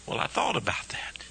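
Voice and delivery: breathy whispered aside; hushed aside to the congregation. Real, not acted. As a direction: drop to a whisper, confiding.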